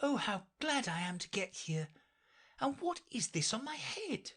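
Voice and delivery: put-on high, light girlish voice; Alice voice. A narrator's reading. Alice to herself, dreaming of being queen, in a high girlish voice. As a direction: read Alice eager and light.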